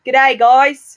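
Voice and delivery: loud hammed-up Australian g'day; mock Australian greeting. Host greets listeners in a loud mock Australian accent. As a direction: shout it like a cartoon Aussie. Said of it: "Right back into Australian accent".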